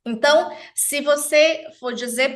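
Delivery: ordinary talk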